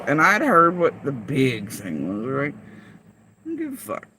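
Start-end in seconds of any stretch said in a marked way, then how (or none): 2.5-4.1 quiet dismissal. Real, not acted. Dismissive repeat of earlier line.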